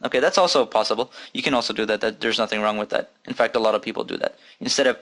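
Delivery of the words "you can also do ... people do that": usual speaking voice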